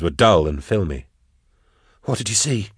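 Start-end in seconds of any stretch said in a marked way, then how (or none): 2.0-2.7 soft, gently urgent question; hushed question. A narrator's reading. Drusha questioning the dying hunter, hushed. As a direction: ask the dying man softly. First asking of dying man.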